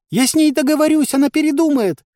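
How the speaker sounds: very high, loud female character voice; insistent shout. A narrator's reading. Village head insists she can persuade the system, insistent shout. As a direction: cry it out stubbornly. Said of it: "крикнула Ариша и даже ножкой топнула".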